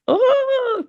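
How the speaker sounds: sudden very high squeal of an oh; surprised oh. Retold or imitated. Her voice breaking free on stage, a sudden high startled oh. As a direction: let the oh leap up in startled surprise.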